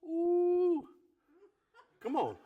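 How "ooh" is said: delighted ooh. Retold or imitated. Reaction to drink offer.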